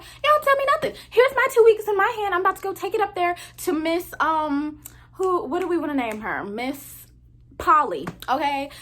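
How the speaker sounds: defiant coworker. Retold or imitated. Raven announcing she'll quit.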